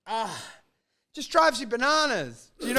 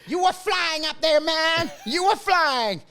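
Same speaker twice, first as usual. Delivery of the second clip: higher, hammed-up New Jersey guy gushing; over the top Jersey boy; 7 semitones higher, slower than usual. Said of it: "over the top, um, Jersey boy".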